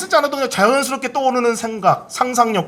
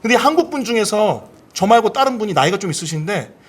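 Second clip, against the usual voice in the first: usual speaking voice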